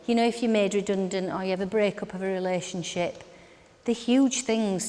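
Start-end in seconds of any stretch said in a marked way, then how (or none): none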